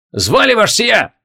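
high, slow soldier's bark. A narrator's reading. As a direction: snap to attention and bark it out. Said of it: "гаркнул молодцеватый гренадёр". (рявкнуть по-солдатски, выше и медленнее)

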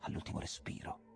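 breathy dramatic whisper. A narrator's reading. Narrator to listeners, whispering. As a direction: let it fade to a breath.

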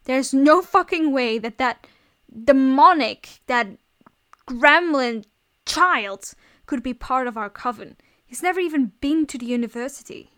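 loud, furious outburst. A narrator's reading. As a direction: round on them in outrage, punching the insults. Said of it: "rounded on Phil furiously".

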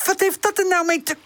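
very high, exasperated; indignant protest. A narrator's reading. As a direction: protest the insult hotly. Pip indignant in the quarrel.

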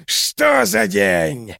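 slow, high howling shout; cackling hell torturer. A narrator's reading. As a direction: howl it in mad triumph. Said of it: "расхохотался". (хохочущий палач)

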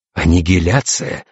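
slow, drawn-out dawning horror; aghast question, understated; horrified. A narrator's reading. Navigator realises what befell the captain, horrified. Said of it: "ужаснулся штурман".

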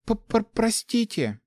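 high, squeaky stuttered apology; flustered little squeak; squeaked stammer. A narrator's reading. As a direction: stammer it out slowly in a tiny high voice. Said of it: "пискнула девушка".

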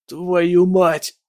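pained groan. Acted. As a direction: groan the curse out in agony. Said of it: "простонал боец". (стон от боли)

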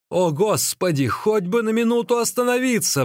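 high-pitched desperate plea; pleading. A narrator's reading. Runaway walker prays to stop for a minute, pleading. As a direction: beg for a moment's rest. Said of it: "взмолился он наконец".

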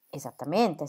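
ordinary delivery, nothing marked

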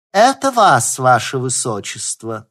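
high, put-on courtly servant voice. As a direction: address her highness like a maid. Alyona pretends to be a servant.

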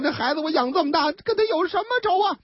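a cold-hearted shock in her voice; lightly voiced bewildered mother; heartbroken lament. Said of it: "这心里面拔凉拔凉".

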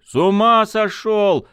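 high, slow furious yell; shouting. Acted. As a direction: yell it out in outrage. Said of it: "заорал Костик".

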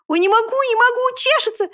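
very high, itchy wailing; high-pitched helpless lament; piglet lamenting. A narrator's reading. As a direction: wail it high, as if the itch is unbearable. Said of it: "причитал он".

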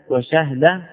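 وشهد as wa-shah-da; وشهد closed up as wa-SHAH-da; silent ha. Shown as a demonstration. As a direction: say وشهد with a after shin, no vowel on ha. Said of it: "يجوز فيه أربع لغات".

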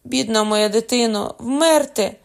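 loud, high wail of grief; anguished cry. A narrator's reading. Old father lamenting his doomed child, anguished cry. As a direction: cry out, climbing on the last word. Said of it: "скрикнув старий".

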